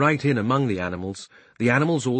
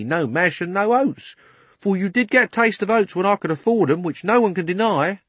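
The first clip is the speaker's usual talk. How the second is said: higher, louder London cabman; cockney cabby; 7 semitones higher, 4 dB louder than usual. Acted. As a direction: justify yourself to the horse. Cabby argues.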